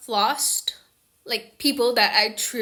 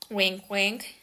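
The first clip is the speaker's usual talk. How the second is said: ordinary talk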